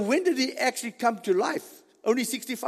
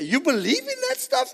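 much higher, questioning mock voice; incredulous doubter. Preacher mimics someone doubting the resurrection, incredulous. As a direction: squeal the question up high in disbelief.

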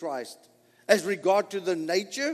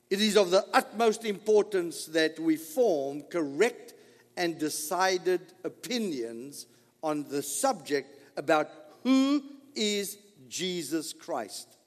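ordinary delivery

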